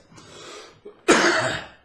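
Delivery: loud deliberate hacking cough to clear the lungs; a big rough demonstration cough; forced cough. Shown as a demonstration. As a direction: cough hard on purpose as a demo. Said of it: "Watch this".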